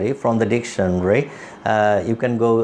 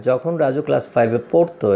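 ordinary delivery

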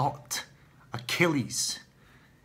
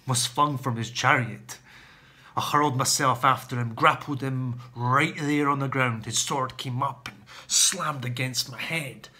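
ordinary talk, nothing marked

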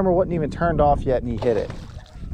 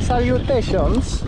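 mock formal greeting; 8 semitones higher, slower than usual. Greeting a rat in the water.

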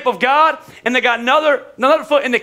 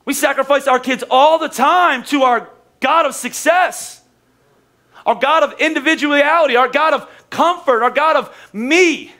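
preaching; 4 semitones higher than usual. Acted. Builds a preaching list.

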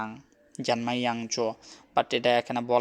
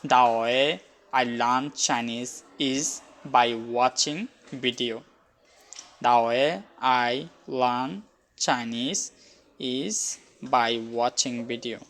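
very slow, deliberate English; slow English reading; much slower than usual. Shown as a demonstration. Teacher reading the English translation slowly to learners. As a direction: read each word out slowly. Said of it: "এখানে English দেওয়া আছে".

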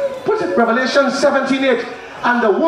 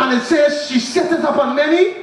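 full-force preaching; 5 semitones higher than usual. Acted. Preacher driving home God's truth to the congregation, shouting. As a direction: thunder it at the congregation.

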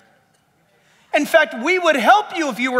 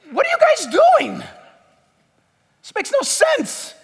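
much higher, bewildered Roman governor; Pilate baffled; 13 semitones higher than usual. Acted. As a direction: throw up your hands as a confused Pilate. Pilate, saying.

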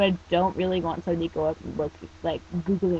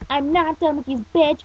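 loud, raised indignant denial; yelling; 8 semitones higher, 6 dB louder than usual. Acted. Teen answers the taunting creature, indignant yell. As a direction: yell back in protest. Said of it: "Watanuki yelled".

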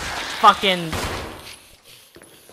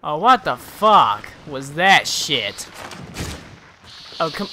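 usual speaking voice

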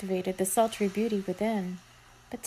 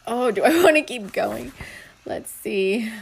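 ordinary delivery

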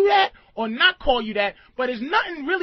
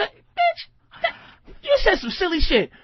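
bitch squealed way up high, cracking; high squealing bitch; 6 semitones higher, slower than usual. Shown as a demonstration. Showing inflections to the room, a high mock-crying squeal. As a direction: shriek bitch like someone said something silly. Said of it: "different inflections of bitch".

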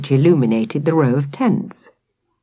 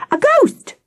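very high, squealed alarm; shriek. A narrator's reading. Victoria alerting everyone to the ghost, shrieking high. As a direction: squeal it in fright. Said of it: "she shrieked".

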